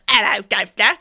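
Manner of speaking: very loud, rough-and-ready British accent, mocking; mock non-posh British accent. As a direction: blurt it in a caricature of un-posh British. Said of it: "you know, instead of".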